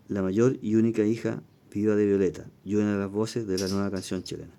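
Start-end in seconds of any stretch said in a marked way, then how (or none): none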